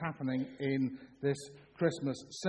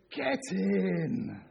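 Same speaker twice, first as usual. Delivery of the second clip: raised, drawn-out football cheer; celebrating fan's shout; 3 semitones higher than usual. Retold or imitated. Son shouting after his team scores, triumphant cheer. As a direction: cheer it like a goal went in. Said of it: "shouts two things, two phrases".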